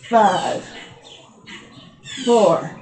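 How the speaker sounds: usual speaking voice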